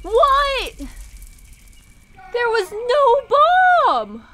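high, loud, drawn-out protest; outraged disbelief. Real, not acted. Gamer hit by unseen bomb, incredulous high yell. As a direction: wail it high and stretch the words.

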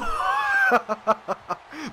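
high-pitched gleeful cackle; gleeful laugh. Real, not acted. Player laughing at the opponent's punishment, gleeful.

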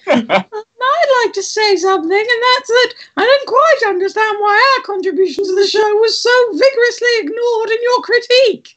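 very high squeaky complaint; indignant puppet character. Acted. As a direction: protest in a tiny, far-too-high puppet voice.

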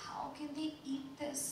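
high, disbelieving disgust. Acted. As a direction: recoil at the food in words. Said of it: "she gestured at her parcel in disgust".

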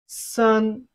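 "San" on a high, level pitch; flat tone. Shown as a demonstration. Teacher models Chinese three for learners, flat-tone "San". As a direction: hold "San" steady with no fall. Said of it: "which is the flat tone".

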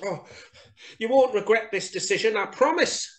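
high, quick mouse voice; relieved little mouse. A narrator's reading. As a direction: gush a promise as a tiny mouse. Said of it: "said Little Mouse".

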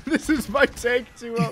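slow, drawn out, through a laugh; slow laughing repeat. Acted. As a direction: lean on is and laugh it out.

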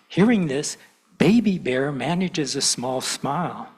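put-on lively read-aloud for kids at church; storytelling to children. A narrator's reading. Minister reads to gathered children, animated storytelling. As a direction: tell it like a picture book.

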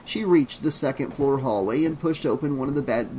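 plain reading voice

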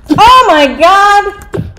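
shocked shout. Real, not acted. Shocked at Russo in game.

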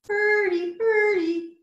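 high, chanted bird call; bird song. A narrator's reading. Bird answering Mole's whistle, high singsong call. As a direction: chant each word high, like birdsong. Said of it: "Somewhere a bird sang".

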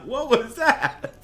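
high, laughing incredulous yelps; laughing disbelief. Real, not acted. Gamer cracking up at something absurd. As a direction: laugh through the confusion.

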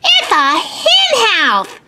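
put-on high, eager boy voice; high-pitched triumphant kid; excited shout. A narrator's reading. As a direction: shout out the answer like a schoolboy. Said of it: "he shouted".

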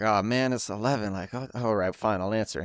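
reluctant resignation. Retold or imitated. Mister Beast gets a late-night call, reluctant resignation. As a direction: give in reluctantly, voice dropped soft. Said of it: "And he's like".

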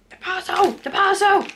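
high, squealing about the parcel; excited squeal. Retold or imitated. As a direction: squeal like a kid at Christmas. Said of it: "I was like".

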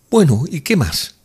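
high, loud, impatient woman; eager prompting. A narrator's reading. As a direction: urge him to go on. Woman impatient to hear more.

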